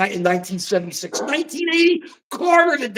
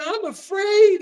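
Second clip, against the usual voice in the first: even higher mock whimper; mock fearful whine; 11 semitones higher, slower than usual. Mocking the MSNBC panel in a higher scared voice. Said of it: "And they're going".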